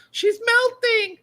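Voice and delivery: high cackling witch. Retold or imitated. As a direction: shriek it like a melting witch. Wicked Witch melting line.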